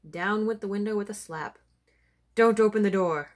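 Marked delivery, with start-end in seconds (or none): 2.3-3.3 sharp, much louder warning cry; cried out. Acted. Doctor crying out to keep the door shut, alarmed. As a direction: shout the order in alarm. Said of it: "cried the doctor".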